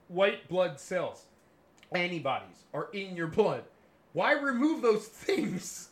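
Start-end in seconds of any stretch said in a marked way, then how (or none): 4.1-5.9 high, loud, drawn-out plea; slow incredulous outcry; exasperated. Real, not acted. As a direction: hit each word in loud disbelief. Exasperated at doctors' bloodletting.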